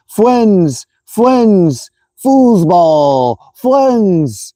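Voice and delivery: high, odd put-on voice repeating the bar name; imitating Eric. Imitating friend Eric trolling the group, high put-on voice. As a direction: mimic a buddy trolling, voice pushed high. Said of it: "He's going".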